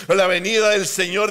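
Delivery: ordinary talk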